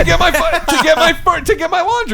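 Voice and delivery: high, loud indignant protest; indignant shout. Real, not acted. Friend defending getting up early for laundry, loud indignant shout. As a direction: shout back in your own defence.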